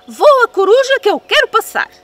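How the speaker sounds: very high, loud bossy shout; shouting at the owl. Acted. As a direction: yell the order with all your might.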